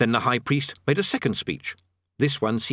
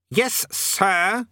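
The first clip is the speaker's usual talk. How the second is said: higher, sharp "Yes, SIR"; imperious snap, rising at the end; snapped correction; 9 semitones higher than usual. A narrator's reading. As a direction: correct an underling's form of address. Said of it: "snapped the king".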